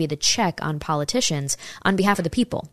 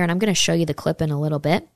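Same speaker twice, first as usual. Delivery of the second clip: ordinary delivery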